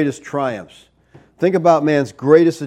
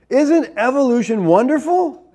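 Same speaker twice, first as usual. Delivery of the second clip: sarcastic; 9 semitones higher than usual. Acted. Preacher mocking evolution.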